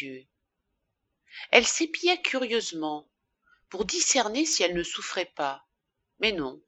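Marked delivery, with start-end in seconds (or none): none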